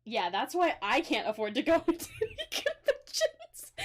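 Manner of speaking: laughing through the words. Real, not acted. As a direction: giggle while you say it. Laughing about being broke.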